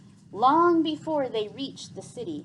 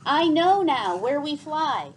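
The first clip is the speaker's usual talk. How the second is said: called out; 4 semitones higher, 6 dB louder than usual. A narrator's reading. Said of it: "he called to Gregor".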